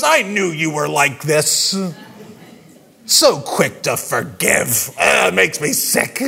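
low, disgusted mock Jonah with a rising shout; sulking Jonah. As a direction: spit out Jonah's resentment at mercy. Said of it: "And Jonah goes".

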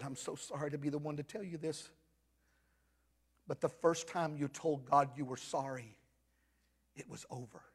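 very soft and low, nearly whispered; retold tender word, dropping away; hushed gentle disclosure. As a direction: break it to him gently. Gently breaking news to man.

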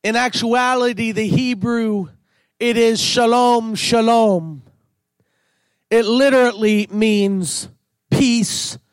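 ordinary talk, nothing marked

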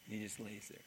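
ordinary delivery

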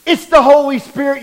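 loud, rising shout on "Holy Spirit"; exclaiming the Holy Spirit. Real, not acted. Preacher proclaiming "It's the Holy Spirit" to the congregation, loud shout. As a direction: call it out to the whole church.

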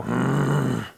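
grumbling wordless growl; imitated growl. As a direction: growl under your breath like an impatient customer. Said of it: "a person that growls".

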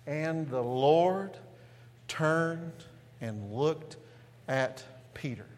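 very slow, word-by-word scripture reading; slow congregational reading. Real, not acted. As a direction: lead the church through the verse slowly, with pauses. Leads congregation reading verse aloud.

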